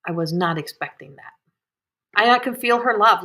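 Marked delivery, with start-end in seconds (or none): 2.2-3.2 close to tears. Real, not acted. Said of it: "I wanna cry".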